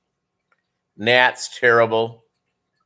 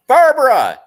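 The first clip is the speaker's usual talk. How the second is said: calling out; 14 semitones higher, 7 dB louder than usual. Real, not acted. Calling out to someone off-mic.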